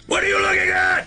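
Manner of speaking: sudden high-pitched shouted impression; hammed-up movie-star yell; Richard Burton. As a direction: bellow it in mock outrage at the camera. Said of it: "Richard Burton looking in the camera going".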